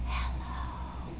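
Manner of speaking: a tiny breathy whisper of hello; quietest whisper. Shown as a demonstration. Librarian shows kids the quietest hello, whispering. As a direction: whisper it so no one hears. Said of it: "as quiet as we can".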